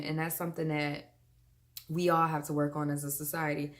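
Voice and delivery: ordinary talk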